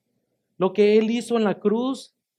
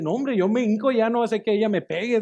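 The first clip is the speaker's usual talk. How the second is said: mock alarmed protest; 5 semitones higher, 5 dB louder than usual. Scared reply to kneeling order.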